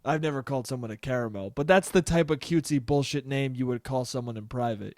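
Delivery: ordinary talk, nothing marked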